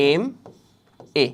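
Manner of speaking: "এমএ" drawn out very slowly as two letters, em, ay; slow, separated letter names in "এমএ". Shown as a demonstration. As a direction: spell out "এমএ" with a gap between the letters. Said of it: "এমএ যদি আমরা উচ্চারণ করি".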